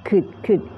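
Could with a short clipped vowel. Shown as a demonstration. Teacher showing the short vowel in could. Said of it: "เป็นเสียงสั้นนะคะ".

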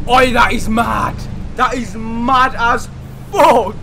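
loud amazed yell; amazed shout. Real, not acted. Gamer yelling in amazement at a gateway. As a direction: bellow it in disbelief.

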